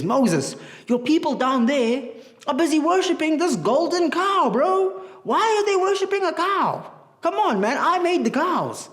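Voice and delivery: high, exasperated God calling Moses bro; God fed up and telling Moses off, pitched high; annoyed God. Acted. God scolding Moses about the golden cow, high and exasperated. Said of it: "God was angry".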